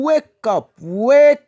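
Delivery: high, drawn-out wake up to learners; teaching wake up. Shown as a demonstration. Teacher modelling wake for French learners. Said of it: "Wake qui veut dire réveiller".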